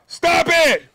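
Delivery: high, loud "Stop it!"; sharp shout. Retold or imitated. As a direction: bark it out to cut her off. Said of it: "you know what I said".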